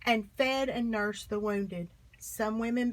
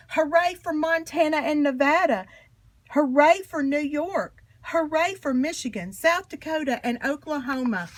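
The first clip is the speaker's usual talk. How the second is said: louder, higher joyful cheer; 4 semitones higher, 6 dB louder than usual. Acted. Suffragists cheering state after state winning the vote, loud and joyful. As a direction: shout each hooray like a crowd celebrating.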